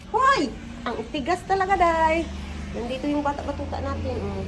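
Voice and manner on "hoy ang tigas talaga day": high, loud exclaim at a stuck window; frustrated outcry. Real, not acted. Vlogger fighting a stuck window, exasperated cry. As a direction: burst out at the stubborn window.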